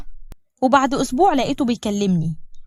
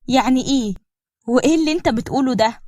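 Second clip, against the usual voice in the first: plain reading voice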